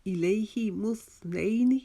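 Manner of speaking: slow इलैहि मुस्नैनी with held "hi"; measured recitation. Shown as a demonstration. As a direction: chant इलैहि मुस्नैनी, short final "ni". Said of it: "इलैहि को हमने एक अलिफ".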